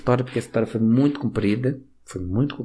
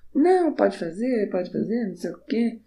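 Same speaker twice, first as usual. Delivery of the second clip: imitating the seller; 11 semitones higher than usual. Mimicking the seller telling him to go ahead. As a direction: play the pushy seller brushing off doubts.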